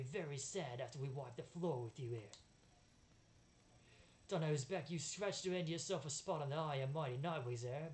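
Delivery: bad British accent, cocky villain; Barker. Barker threatens to wipe the floor, bad British accent. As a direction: menace them in a hammed-up British accent. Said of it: "I relish my intentionally bad British accent".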